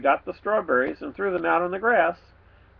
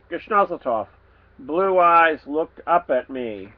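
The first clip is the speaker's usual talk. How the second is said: usual speaking voice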